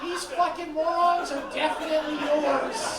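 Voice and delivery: laughing loudly, slow and incredulous; loud laughing disbelief at the brother. Retold or imitated. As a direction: crack up at the brother's kids. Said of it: "And I was like".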